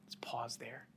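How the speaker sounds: whispered aside to the congregation; hushed whisper. Real, not acted. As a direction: draw them in with a whisper. Preacher hushes for reflection.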